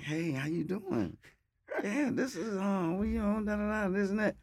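high, soft put-on girl's voice on a prank call. Pranking Mike on the phone, high soft girl voice. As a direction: pitch way up and go soft, playing a girl. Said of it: "So I act like I was a girl".

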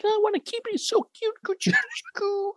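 playing Herman, cooing at a bear cub; baby talk. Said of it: "Herman's like".

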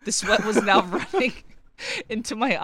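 words pushed out through a laugh; breaking into laughter mid-sentence. Real, not acted. Reading gross review, cracking up.

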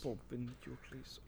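slow, very soft botched German, almost Irish; mock German accent gone Irish. Co-host echoing the slang, slow German accent slipping into Irish. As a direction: murmur a bad German accent, drifting Irish. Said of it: "You guys sound Irish".

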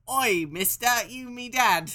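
high, loud Cockney urchin squeak; hammed-up Cockney child doll voice; Victorian urchin doll. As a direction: mimic a creepy Victorian doll talking. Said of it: "trying to mimic, the Victorian doll that says".

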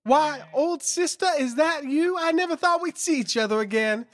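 put-on high, fluttery old woman; old lady voice. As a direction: gush like a genteel old lady. Old lady character reunion.

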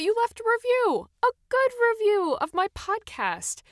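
high, loud burst of delight; thrilled exclamation. Real, not acted. Podcaster amazed someone left a good review, thrilled exclamation.

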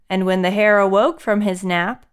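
usual speaking voice

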